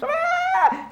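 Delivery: put-on a sudden, very high, loud toddler shriek; piercing child scream. Retelling a toddler screaming in a bookstore, piercing shriek. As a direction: let out a shrill tantrum scream. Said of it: "And this scream's".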